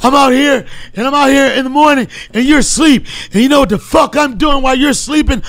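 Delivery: profane motivational rant while jogging, huffing between bursts; David Goggins impression. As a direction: spit out self-tough sermon mid-run with heavy panting. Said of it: "incredible David Goggins".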